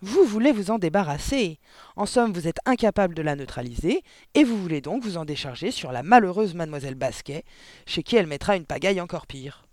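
blustering headmistress rant; loud accusation. A narrator's reading. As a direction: accuse as if seeing through a scheme. Headmistress loudly accuses the teacher.

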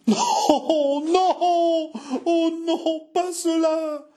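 very high, wailing refusal; heart-rending cry. Acted. Jeanne refusing to renounce her marriage, heart-rending cry. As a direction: wail it out in melodramatic anguish. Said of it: "dans un cri déchirant".

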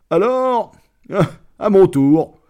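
high, loud sinister turn; spring the trap with relish; menacing lion. Acted. Lion telling the wolf it is his turn, high loud menace.

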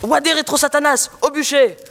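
indignation exaltée. Acted. Le curé condamne un livre.

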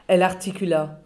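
plain reading voice

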